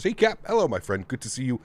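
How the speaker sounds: normal talking voice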